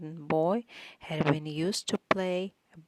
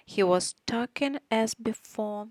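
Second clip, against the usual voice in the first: ordinary delivery, nothing marked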